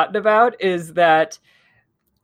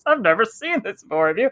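higher-pitched mock commentator; John Madden; 4 semitones higher than usual. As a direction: play the baffled commentator asking his partner. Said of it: "commentary with John Madden going".

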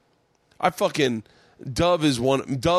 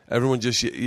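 usual speaking voice

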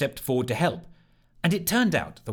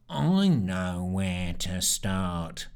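lower, very slow dull sneer; sneery voice; 5 semitones lower, much slower than usual. Acted. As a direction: drag it out unheard. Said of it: "sneery voice again".

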